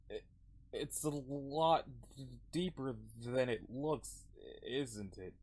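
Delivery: slow, halting, fading voice; downcast character. Acted. Voices Odin down in the water.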